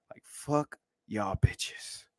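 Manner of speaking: unvoiced, slow, hushed; mock whisper. Streamer voicing commenters' dismissal as a slow whisper. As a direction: breathe it out with contempt.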